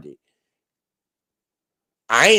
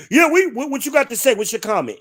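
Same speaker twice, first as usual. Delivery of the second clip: much higher, mocking taunt; mocking hosts; 10 semitones higher, faster than usual. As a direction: mimic their gotcha question. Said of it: "mocking the hosts' challenge".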